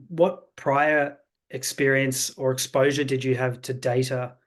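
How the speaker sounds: usual speaking voice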